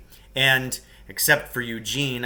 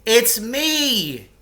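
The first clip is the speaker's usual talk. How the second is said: high, loud shout from the pit; 10 semitones higher, 7 dB louder than usual. Acted. Man stuck in a pit shouting to his rescuers. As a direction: call out to be found. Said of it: "he shouted every few minutes".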